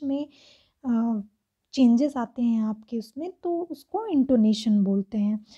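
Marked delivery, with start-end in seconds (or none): none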